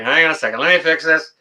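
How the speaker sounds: ordinary talk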